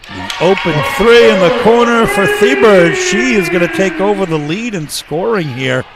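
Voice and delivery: loud, high, thrilled call of the shot; excited play-by-play. Real, not acted. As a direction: shout it out as the shot drops. Call of a made three.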